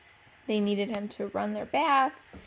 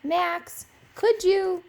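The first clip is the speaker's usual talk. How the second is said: Irene calling; 7 semitones higher, 6 dB louder, slower than usual. Acted. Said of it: "he heard Irene's voice".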